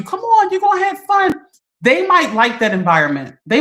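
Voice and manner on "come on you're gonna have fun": high, coaxing party-friend voice; mock peer pressure, sing-song. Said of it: "people will tell you".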